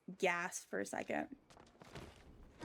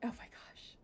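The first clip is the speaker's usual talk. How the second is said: whisper; 11 dB quieter than usual. Real, not acted. Stunned at the rare drop.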